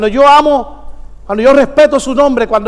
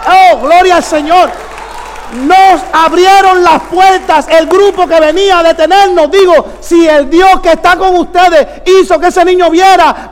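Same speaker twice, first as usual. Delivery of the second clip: higher-pitched, forceful pulpit proclamation; fervent preaching; 3 semitones higher than usual. Real, not acted. Preacher testifying of a miracle to the church, fervent. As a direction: proclaim it to the whole congregation.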